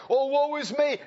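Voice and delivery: high mock sigh of woe; mock lament. Preacher mocking a woeful complainer, high. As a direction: ham up the self-pity. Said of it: "went around going like".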